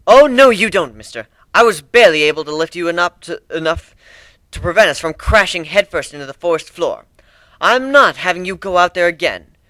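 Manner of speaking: high, loud scolding mare; bossy mothering unicorn. Acted. Trixie scolds Griff for getting up, bossy mothering. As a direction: order him back to bed like a mother.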